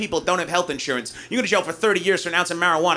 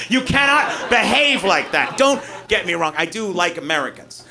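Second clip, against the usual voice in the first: normal talking voice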